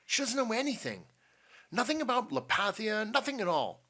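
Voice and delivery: put-on high, pleading boy's protest; urgent insistence. A narrator's reading. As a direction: jump in urgently to protect her. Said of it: "said Jupiter Jones urgently".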